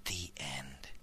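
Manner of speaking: soft low whisper; whispered ending. Acted. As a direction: whisper the last words.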